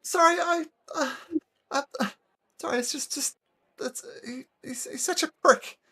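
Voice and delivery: high, weepy stammering voice; tearful halfling. Acted. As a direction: sniffle through the apology. Halfling crying.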